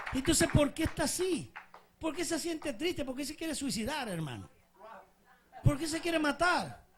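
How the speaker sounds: loud, high-pitched sermon cry; full-force preaching. Real, not acted. Preacher challenging believers about despair, full-force preaching. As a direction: hurl urgent questions at the congregation.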